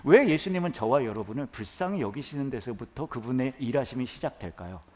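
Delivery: usual speaking voice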